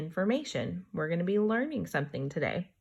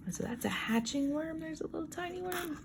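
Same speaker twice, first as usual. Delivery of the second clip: put-on higher, soft cooing over a tiny baby worm; tender coo; 10 semitones higher, 9 dB quieter than usual. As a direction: coo gently at the little worm.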